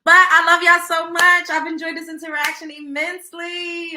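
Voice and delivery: 1 loud gushing sign-off. Real, not acted. Loving sign-off to viewers.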